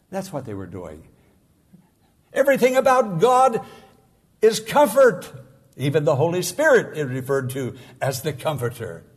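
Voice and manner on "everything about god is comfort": much higher and louder preaching cry; exultant, raised declaration; ringing proclamation. Acted. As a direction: lift it up and proclaim it.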